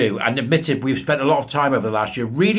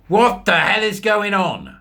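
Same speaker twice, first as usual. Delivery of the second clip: louder, slow and high, fed up; exasperated outburst; 7 semitones higher, 5 dB louder, slower than usual. Real, not acted. Ranting host fed up with the chief executive's pay, exasperated. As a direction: demand an answer, drawn out in exasperation.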